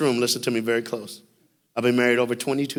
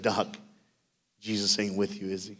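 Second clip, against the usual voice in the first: quiet bedside question; 4 semitones lower, 10 dB quieter than usual. Retold or imitated.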